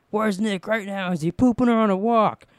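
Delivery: hammed-up hysterical yell; mock screaming. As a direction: screech the question like a captive. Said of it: "And then she's screaming like that".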